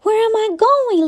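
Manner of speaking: mildly acted cry of a lost little snake. A narrator's reading. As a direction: call it out high, a touch plaintive. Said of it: "Lucy cried".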